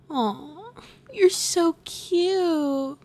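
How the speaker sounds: high, slow doting coo. Acted. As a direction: coo over a cute pet. Cooing at the pet.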